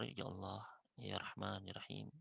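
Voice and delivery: tender quiet supplication; soft gentle prayer. Shown as a demonstration. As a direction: pray it softly, humbly. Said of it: "Dengan suara yang lembut".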